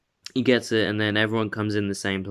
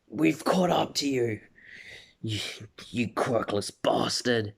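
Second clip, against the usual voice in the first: Bakugo's taunt; 3 dB quieter than usual. Acted. Bakugo taunting Deku after catching up, light mock sneer. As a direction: sneer the insult at the rival who got ahead. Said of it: "Bakugo says that".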